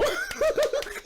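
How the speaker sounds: high rising laugh; real burst of laughter. Real, not acted. Laughing at the friends' message, high laugh.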